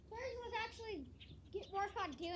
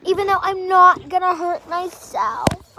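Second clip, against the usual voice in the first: emphatic insisting; 24 dB louder, slower than usual. Real, not acted. Insisting against mom's worry.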